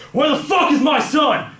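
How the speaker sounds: very high, loud, drawn-out shout; retold furious yell. Dad slams kid into car demanding his son, yelling. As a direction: imitate an enraged father yelling. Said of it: "Just yelled at him".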